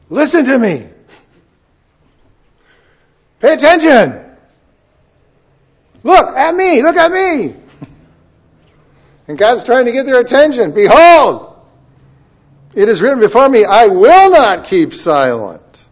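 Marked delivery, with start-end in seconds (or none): 0.1-7.5 much higher, louder parent demanding attention; scolding parent. Retold or imitated. Parent scolding a child who won't listen, loud and high. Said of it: "if your child's not listening to you". 10.7-15.8 preaching at full force. Acted. Reads God's words with force.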